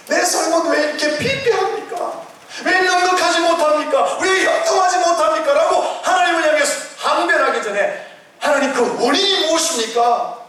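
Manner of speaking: rising pulpit fervour; preaching at full force. Acted. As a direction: preach it up high and strong. Acted prayers within sermon.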